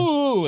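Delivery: high hammed-up ooh; mock oops. Mocking the actor dropping papers, a high ooh. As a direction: squeal a silly oops. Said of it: "then Nicholas Holt's like".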